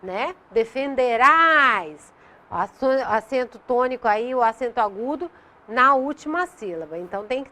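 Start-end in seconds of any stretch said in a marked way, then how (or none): 0.5-2.1 "Defenderás" slow, with the final "-rás" stressed and louder; stress on last syllable. Shown as a demonstration. Teacher points out the accent, stressing "Defenderás" on "-RÁS". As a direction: lean on the "-RÁS" of "Defenderás", drawn out. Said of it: "atenção também à acentuação".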